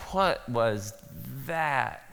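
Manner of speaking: softly stunned, under the breath; stunned quiet. Retold or imitated. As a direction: murmur it, dazed. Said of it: "and you walk off like".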